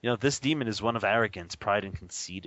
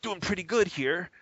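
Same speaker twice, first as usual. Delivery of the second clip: smug self-satisfaction; 8 semitones higher than usual. Retold or imitated. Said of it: "You're like".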